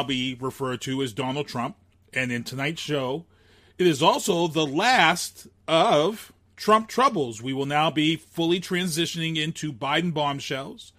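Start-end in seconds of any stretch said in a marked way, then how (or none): 3.8-7.4 slow, loud segment title boom; announcer voice. Acted. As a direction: announce the title like a show host.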